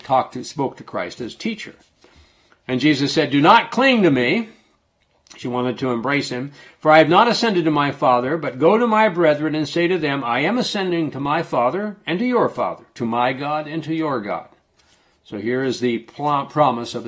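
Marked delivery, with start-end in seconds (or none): 2.7-14.5 raised, ringing Bible reading voice; lifted preacher's reading tone; scripture reading. Acted. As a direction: read the verses out to the congregation. Reads scripture aloud.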